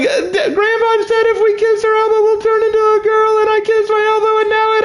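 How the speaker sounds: put-on high, wailing child voice, laughing through tears; hammed-up tearful child, pitched very high; crying kid. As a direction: sob it out like a panicked little boy. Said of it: "I run out of my room crying".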